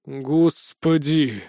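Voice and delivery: slow, drawn-out despair; exasperated groan. A narrator's reading. Narrator dreads another smitten girl, exasperated groan. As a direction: drag the word out wearily.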